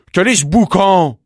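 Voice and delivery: loud, high, grumpy outburst; irritated shout. A narrator's reading. Bumblebee at his door to a noisy visitor, irritated shout. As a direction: shout crossly at whoever is making the noise.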